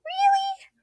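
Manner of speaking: very high squeak of disbelief; astonished mouse squeak; surprised mouse. A narrator's reading. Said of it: "asked the mouse".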